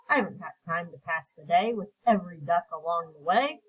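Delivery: usual speaking voice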